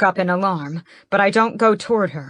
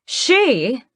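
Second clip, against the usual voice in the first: grandly; 8 semitones higher, 5 dB louder than usual. A narrator's reading. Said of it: "Morgan pronounces grandly".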